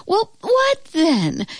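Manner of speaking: high, drawn-out impatient demand. A narrator's reading. Velma pressing Sophie to name her problem, demanding. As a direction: demand an answer, high and stretched. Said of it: "demanded Velma".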